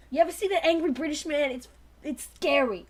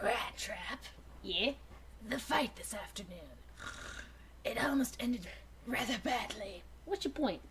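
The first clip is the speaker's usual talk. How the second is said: hushed dialogue; 6 semitones lower, 13 dB quieter than usual. Acted. Quiet dialogue, whispered.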